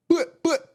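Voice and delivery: high, loud "But, but" like a dancehall horn; Jamaican sound effect. Pretend. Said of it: "I gotta do that because it's like when I'm talking to a Jamaican".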